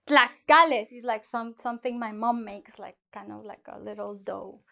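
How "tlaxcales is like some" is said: usual speaking voice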